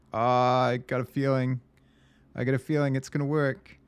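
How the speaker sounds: excited. Real, not acted. Nervous hope the solution passes.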